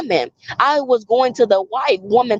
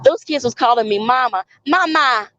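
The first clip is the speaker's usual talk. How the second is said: ordinary talk, nothing marked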